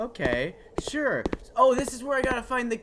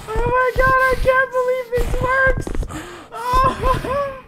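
very high, laughing through the words; laughing squeal; 5 dB louder, much slower than usual. Real, not acted. Amazed the trick works, laughing squeal.

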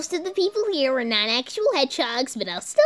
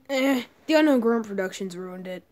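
normal talking voice

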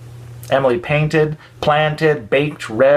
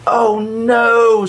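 high, louder cry of dismay; a little girl seeing her toy broken; dismayed child; 9 semitones higher, 4 dB louder than usual. A narrator's reading. Emily sees her crystal ball break, dismayed cry. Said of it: "said Emily".

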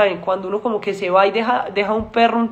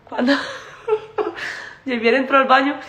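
breaking into laughter mid-story; laughing; 4 semitones higher than usual. Real, not acted. Retelling the bathroom scare to fans, laughing. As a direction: giggle through the words.

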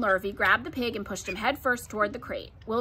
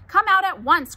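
high, loud motherly order; sharp cry; 8 semitones higher, 7 dB louder than usual. A narrator's reading. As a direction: call the child out urgently. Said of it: "cried Missus Arabelle".

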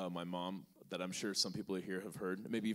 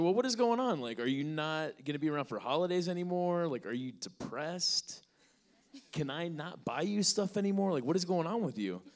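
mom's worried voice; 7 semitones higher, 3 dB louder than usual. Retold or imitated. Mom voiced in retold story.

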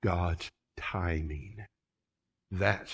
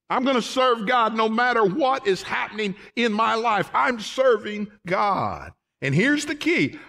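higher, louder preaching build-up; preaching at full force; 11 semitones higher, 4 dB louder than usual. Acted. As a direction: climb toward the big point.

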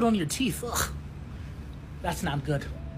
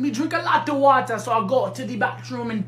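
put-on Jamaican; 3 dB quieter than usual. Interrupting a friend to mention the bathroom, put-on Jamaican accent. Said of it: "acting like you Jamaican".

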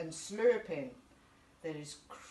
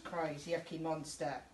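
usual speaking voice

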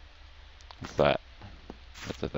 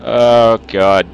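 loud high frightened cursing; jump-scared, yelling; startled scream; 3 semitones higher, 10 dB louder, slower than usual. Real, not acted. Another scare.

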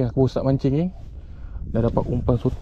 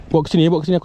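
normal talking voice

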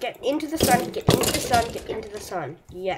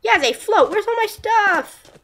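alarmed shout; 11 semitones higher, 8 dB louder than usual. Real, not acted. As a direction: cry out for your lost items. Items lost.

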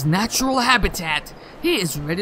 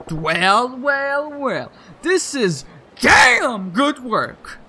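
gruff praise; 2 semitones higher, much slower than usual. Acted. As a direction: bellow it out slowly, impressed. Gruff craftsman praising work.

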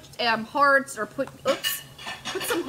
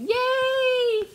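excited yay; 4 dB louder than usual. Real, not acted. Cheering about new arrival.

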